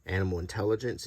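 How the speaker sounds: ordinary talk, nothing marked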